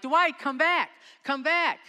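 loud, high pleading call; desperate shout. Retold or imitated. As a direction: scream after someone swimming away. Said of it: "I'm like".

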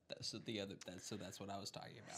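low quick aside; quiet. Real, not acted. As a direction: mumble it to yourself.

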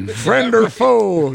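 high, slow guard's challenge; drawn-out sentry call. Pretend.

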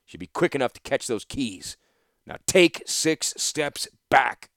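cold threat with the bow drawn; stern master archer. Acted. Instructor Gerard ordering Torsten back, stern and loud.

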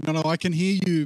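usual speaking voice

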